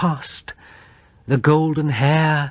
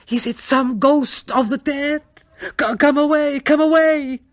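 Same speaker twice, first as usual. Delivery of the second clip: high, pleading, louder toward the end; terrified plea; 13 semitones higher, 3 dB louder than usual. Acted. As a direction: beg him to flee in terror, pitched high. Terrified wife begs him to leave.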